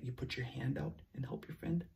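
soft quick whisper; gentle quick whisper. Real, not acted. Teacher whispers to children.

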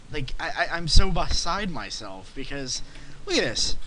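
normal talking voice

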